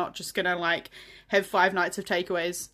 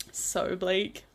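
ordinary delivery

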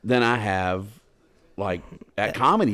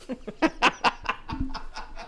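high airy laughter at his own joke; real silent laugh; breathy laugh; 4 dB quieter than usual. Real, not acted. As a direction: wheeze with laughter. Laughing after punchline.

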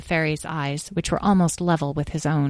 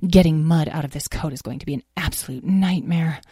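ordinary delivery

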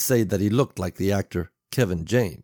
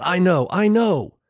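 higher, louder, drawn-out snap; barked; 10 semitones higher, 5 dB louder than usual. A narrator's reading. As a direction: cut her off impatiently, raising pitch and volume. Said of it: "he barked at her".

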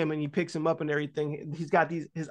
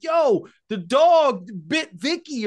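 higher, louder, slow yell about the dog; a slow-motion shout in the style of a retold scream; 5 semitones higher, 5 dB louder, much slower than usual.

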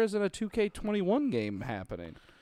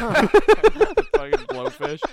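laughing; 10 semitones higher, 8 dB louder, much slower than usual. Real, not acted. Laughing fit.

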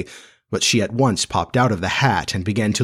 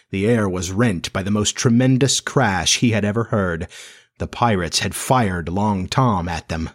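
ordinary delivery

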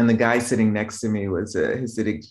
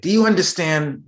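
raised, louder parental demand; higher-pitched, annoyed challenge to a child; annoyed dad; 8 semitones higher, 5 dB louder than usual. Retold or imitated. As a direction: imitate an irritated father checking you heard. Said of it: "if he got annoyed".

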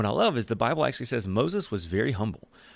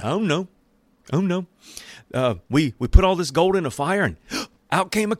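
panicked excuse; 7 semitones higher, 6 dB louder than usual. Retold or imitated.

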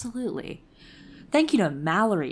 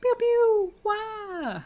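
high pew sound effects; 14 semitones higher, slower than usual. Pretend. Sound effects after patron thanks.

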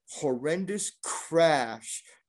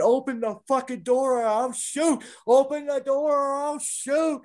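high, drawn-out animalistic shout; screaming threat; 11 semitones higher, 4 dB louder than usual. Retold or imitated. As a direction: imitate a man gone ballistic, screaming threats. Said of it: "he was just screaming".

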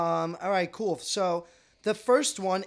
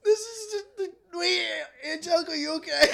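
high, crying with laughter; squealing through laughter; laughing; 10 semitones higher, 3 dB louder than usual. Real, not acted. Laughing at friend stuck on zipper.

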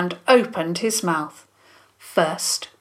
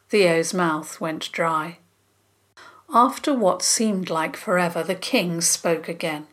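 ordinary delivery

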